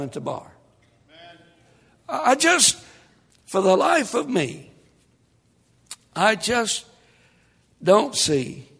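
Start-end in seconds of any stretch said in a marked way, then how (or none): none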